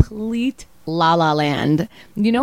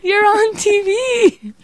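high, slow, drawn-out cry; hammed-up stoned announcement; stoned excitement; 4 dB louder, much slower than usual. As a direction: stretch it out high in a silly voice. Same acted stoned announcement.

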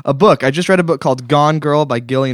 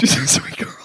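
whisper. Real, not acted. Whispered reply.

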